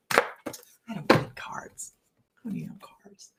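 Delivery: whispered, slow grumble to herself; muttered whisper. Real, not acted. Host grumbling to herself about the cue cards, whispered. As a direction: mutter it under your breath.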